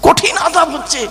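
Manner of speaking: high, emphatic cry of warning without extra volume; high-pitched exclamation. Retold or imitated. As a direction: jump up high on the repeat. Preacher repeats grave warning, intensified. (উঁচু সুরে জোর দিয়ে সতর্কবাণী)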